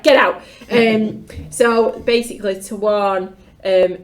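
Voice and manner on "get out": louder, higher acted dismissal; curt mock order. Leaders packing the returned runner off, curt mock order. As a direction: send him straight back out, abruptly.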